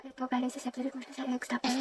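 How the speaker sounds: almost no voice at all, mouthing the words; barely audible. Acted. Classmate barely audible while explaining math. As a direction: speak so softly nobody hears. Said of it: "Sobrang hina ng boses mo".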